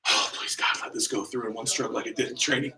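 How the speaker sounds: soft, low pleading under the breath; silent desperate prayer. Retold or imitated. Nervous new rep praying the knife works, pleading. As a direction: plead privately, as if praying inwardly. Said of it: "In my head, I'm praying".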